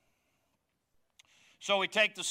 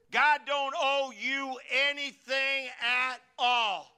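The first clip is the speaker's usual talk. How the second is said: louder, each word hammered slowly; slow forceful rebuke; 5 semitones higher, 6 dB louder, slower than usual. Acted. Preacher firmly telling the congregation God owes nothing, slow and loud. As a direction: lay down the law.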